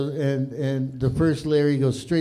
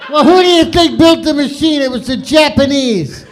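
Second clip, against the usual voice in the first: much louder and higher, laughing; loud punchline; 11 semitones higher, 15 dB louder than usual. Acted. Comic shouting the punchline at the crowd. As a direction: belt the punchline out.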